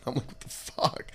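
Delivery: breathy whisper of disbelief. Retold or imitated. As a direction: hush it as a stunned thought. His thought after losing.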